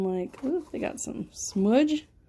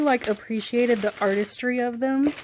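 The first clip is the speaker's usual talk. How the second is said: ordinary talk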